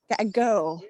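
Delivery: usual speaking voice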